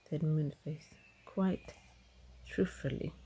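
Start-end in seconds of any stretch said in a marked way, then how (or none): none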